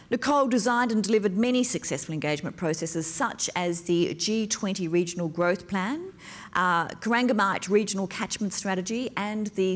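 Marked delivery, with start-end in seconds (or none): none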